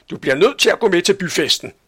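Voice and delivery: put-on fast, loud order to the child; bossy aunt. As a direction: rattle off a firm command. Tante Sofie befaler pigen. (hurtig, bestemmende tante)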